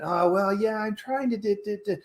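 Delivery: high, fading, unsure mumble; weak hesitant answer. Pretend. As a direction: trail off like you lack conviction.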